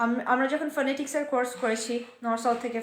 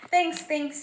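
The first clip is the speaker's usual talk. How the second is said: normal talking voice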